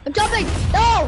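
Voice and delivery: high, loud cry of protest; alarmed yell. Real, not acted. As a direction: call out, bursting on the last word. Player cries out as things go wrong.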